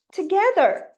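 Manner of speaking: together said loud and high in English; English demo word. Shown as a demonstration. Teacher demonstrating together to parents, loud English word. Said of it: "Par exemple".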